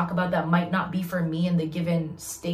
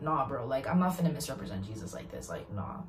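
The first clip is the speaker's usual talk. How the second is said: quiet low mutter; 6 semitones lower, 10 dB quieter than usual. Retold or imitated. Quoted inner refusal, low.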